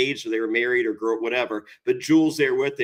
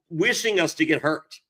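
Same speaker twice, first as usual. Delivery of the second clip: usual speaking voice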